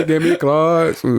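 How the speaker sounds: high, slow put-on voice; slow high mock of the rap line; mock rapper voice. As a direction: drawl it out in a mocking voice. Repeats mocking rap line.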